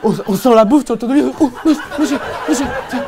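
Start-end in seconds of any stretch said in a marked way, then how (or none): 1.4-3.0 higher, lightly voiced rich kid taunt; mock taunting. Rich kid taunts the poor kids, smug mock.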